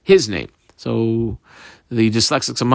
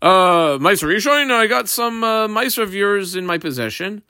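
light, higher voice of the field owner telling the Levi; lightly voiced farmer, pitched up and casual; field owner speaking; 7 semitones higher than usual. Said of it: "Yisrael who says to a Levi".

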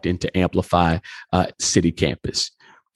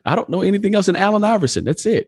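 much higher, protesting cluelessness; baffled disbelief; 13 semitones higher, faster than usual. Retold or imitated. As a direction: retell it in a high, incredulous voice. Retelling his baffled reaction to wife's suggestion.

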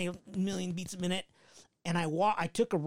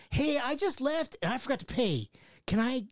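high, sweet customer-service tone; polite phone voice; 9 semitones higher than usual. Retold or imitated. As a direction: play both sides of a polite call. Phone call voices acted.

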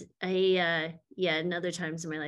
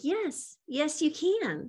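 a higher, slow, coaxing "yes, you can"; insisting encouragement; 7 semitones higher, much slower than usual. Retold or imitated. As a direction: talk him into it with gentle insistence. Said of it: "I was like".

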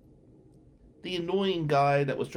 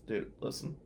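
ordinary talk, nothing marked

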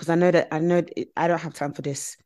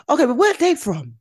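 high, pushy mock interrogation; mock nosy questioning; 7 semitones higher, 3 dB louder than usual. Friend mimics a pushy questioner with a high prying voice. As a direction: pester them in a high prying voice. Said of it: "They'll be like".

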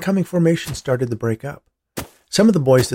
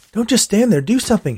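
raised, urgent plea; 9 semitones higher, 4 dB louder than usual. A narrator's reading. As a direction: push him to act. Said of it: "he urged".

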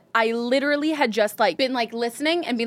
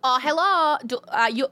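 put-on Midwestern voicemail accent; 3 semitones higher than usual. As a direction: greet callers in a Midwestern accent. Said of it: "in that accent".

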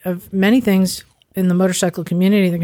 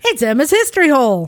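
big announcer intro, higher; 6 semitones higher than usual. Acted. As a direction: announce the segment title. Announcing the show segment.

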